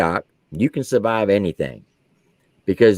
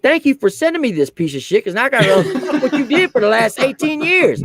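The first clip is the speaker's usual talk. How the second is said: laughing insult; 12 semitones higher than usual. Retold or imitated. Sergeant insults mother on phone.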